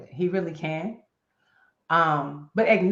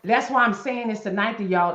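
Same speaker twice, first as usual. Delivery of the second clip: normal talking voice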